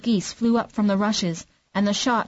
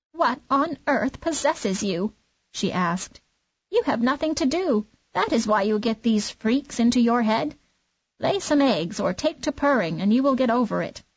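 fussy clucking hen; 3 semitones higher than usual. Acted. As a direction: scold him like a bossy hen. Hen scolds the duckling.

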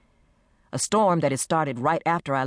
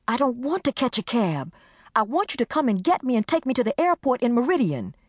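high, insistent demand; demanding friend; 9 semitones higher than usual. Acted. Desiree insisting Carmen drive her to the airport.

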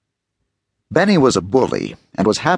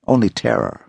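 plain reading voice

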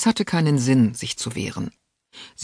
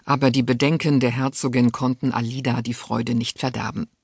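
plain reading voice

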